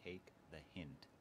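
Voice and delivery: slow, softened imitation of whispering; mock whisper. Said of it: "he just whispers".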